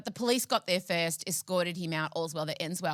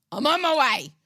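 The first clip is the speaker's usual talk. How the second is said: determined; 5 semitones higher, 8 dB louder than usual. Retold or imitated. Answering friend's call for help.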